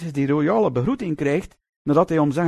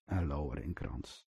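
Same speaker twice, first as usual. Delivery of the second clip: soft echo; 18 dB quieter than usual. Shown as a demonstration. Preacher echoing his answer to the congregation, softly. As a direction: repeat it much softer, almost to yourself.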